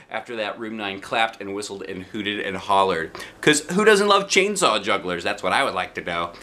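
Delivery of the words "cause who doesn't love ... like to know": plain reading voice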